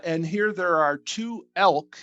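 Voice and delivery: normal talking voice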